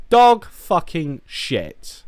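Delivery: DOG punched high and loud, "Dog fucking shit" drawn out; emphatic verdict. Real, not acted. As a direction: hit "Dog" hard and slow down each word of "Dog fucking shit". Emphatic repeat of the film verdict.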